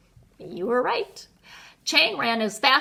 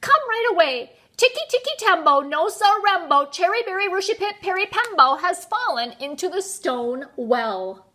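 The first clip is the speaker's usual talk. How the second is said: high, urgent, rapid-fire shout; 7 semitones higher, 4 dB louder than usual. A narrator's reading. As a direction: rattle out the emergency at raised pitch. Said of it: "shouted Chang".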